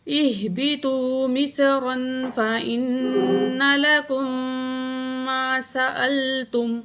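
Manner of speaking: slow, drawn-out tajweed chant; Quran recitation. Shown as a demonstration. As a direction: recite slowly with long vowels. Teacher recites, partly chanted. (آہستہ تجوید کے ساتھ تلاوت)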